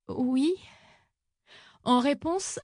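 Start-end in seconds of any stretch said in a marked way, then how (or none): 0.1-0.5 tiny plaintive voice, sliding upward; thin, very plaintive. A narrator's reading. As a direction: whimper the word meekly. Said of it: "D'une voix ténue, encore plus plaintive, elle répéta". (voix ténue, très plaintive)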